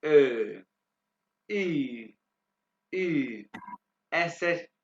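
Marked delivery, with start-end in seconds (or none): 0.0-0.6 "ए" as rounded German ö, held long; O-umlaut sound. Shown as a demonstration. Teacher demonstrates O umlaut, rounded drawn-out "ए". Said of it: "दोबारा pronounce करके बताऊंगा". 1.5-3.5 "ई" as rounded German ü, held long; U-umlaut sound. Shown as a demonstration. Teacher demonstrates U umlaut, rounded drawn-out "ई". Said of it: "दोबारा pronounce करके बताऊंगा".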